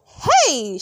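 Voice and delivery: very loud yell; fan shout. A narrator's reading. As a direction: shout from the bleachers. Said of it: "shouted a fan".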